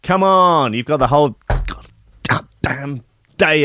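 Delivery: loud, high wake-up call; hyped show opener. Acted. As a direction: rouse the audience like a rowdy announcer.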